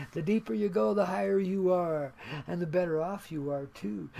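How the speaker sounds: ordinary talk, nothing marked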